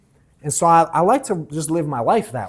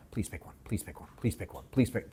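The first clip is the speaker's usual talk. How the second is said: much softer, breathless whisper of hope; whispered pleading; 5 semitones lower, 13 dB quieter than usual. Retold or imitated. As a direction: beg under your breath, over and over. Silently begging customer to buy.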